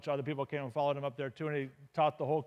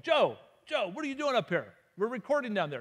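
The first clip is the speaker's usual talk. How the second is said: higher, louder call up the stairs; 6 semitones higher, 4 dB louder than usual. Retold or imitated. Band members calling Joe back to the recording, raised voices. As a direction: shout for Joe from below.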